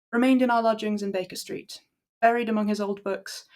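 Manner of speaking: ordinary delivery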